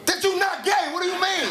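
high-pitched mock disbelief; comic indignant insistence; exasperated protest. Acting out exasperated argument with friend.

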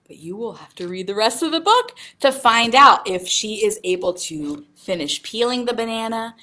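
ordinary delivery, nothing marked

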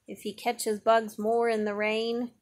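ordinary delivery

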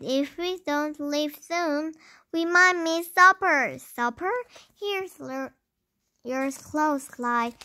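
plain reading voice

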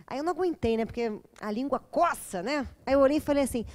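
ordinary delivery

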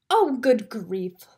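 slow, fed-up sigh of words; mild exasperation; exasperated exclaim. A narrator's reading. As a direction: roll your eyes through it. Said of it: "Lucy exclaims".

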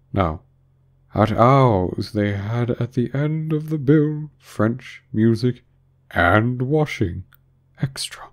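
very slow, stretched-out list; self-satisfied Mock Turtle. A narrator's reading. Mock Turtle boasting of the school bill, slow and smug.

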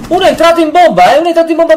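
high, loud, fast shout; combat callout. Real, not acted. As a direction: yell the warning to the team. Urgent callout to team.

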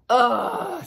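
high, loud disgusted groan; disgusted ugh. A narrator's reading. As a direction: scoff in disgust. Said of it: "said the other naked mole rats".

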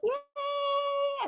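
squeaky high mock yay; toddler cheer. Mother imitating a toddler's triumphant yay. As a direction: cheer way up like a tiny child. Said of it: "they're like".

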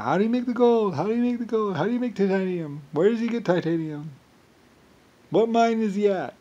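high, nagging mock voice of greedy seekers; mocking whiny people. Mocking people begging for his gold secret, high and whiny. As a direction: whine it like a nosy pest. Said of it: "Everybody wandering around".

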